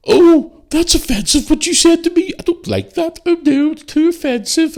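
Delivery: high, loud, whiny mock outrage; shrill parody of someone taking offence; mock offended snowflake. Shop lady mimicking touchy customers in a high whiny mock voice.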